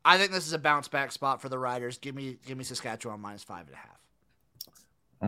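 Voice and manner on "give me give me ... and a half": whispered, conspiratorial betting tip; whispering. Acted. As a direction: whisper it like a secret. Confiding his pick in a whisper.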